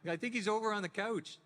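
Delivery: high-pitched, loud Canadian accent, eh at the end; old Canadian accent. Shown as a demonstration. Showing the young Canadian accent he had before voiceover. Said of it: "I used to speak with a Canadian accent".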